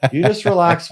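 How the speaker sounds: God insisting he relax; voicing God. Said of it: "God's like".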